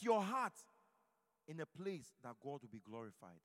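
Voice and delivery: loud, driving sermon delivery; fiery preaching. Acted. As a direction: preach it hard to the congregation. Preaching at full force.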